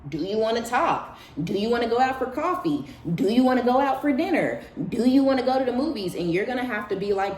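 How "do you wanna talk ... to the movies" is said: higher, faux-friendly offers, speeding up at the end; mock sweet invitations. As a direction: imitate the ex sucking up with a list of offers. Mimicking ex's sudden invitations.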